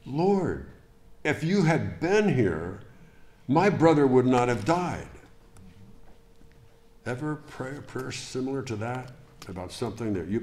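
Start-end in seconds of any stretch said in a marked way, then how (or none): 0.1-5.1 Martha's grieving plea. Acted. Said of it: "with Martha saying".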